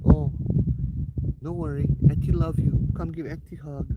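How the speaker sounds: high, sweet auntie voice; flighty eccentric aunt. Retold or imitated. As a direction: coo it like a doting auntie.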